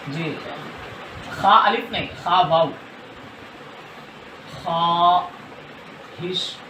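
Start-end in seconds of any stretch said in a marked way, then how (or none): 4.5-6.6 ख्वाहिश stretched very slow, the वाव silent; drawn out word. Shown as a demonstration. Teacher shows ख्वाहिश to students, very slow. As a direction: draw out ख्वाहिश slowly. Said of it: "ये देखिए".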